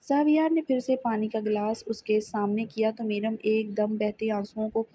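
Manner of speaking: ordinary delivery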